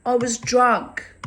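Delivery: loud, high mock Romanian man making excuses; Romanian accent. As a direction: imitate the neighbour's Romanian accent, pleading. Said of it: "he said that in a Romanian accent".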